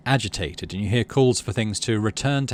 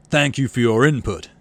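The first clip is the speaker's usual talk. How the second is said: icily; 6 dB louder than usual. Acted. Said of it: "he says icily".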